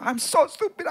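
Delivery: high, self-blaming cry; angry at himself. Retold or imitated. Boy after the crash cursing himself, high and upset. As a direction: berate yourself. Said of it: "marah dengan dirinya".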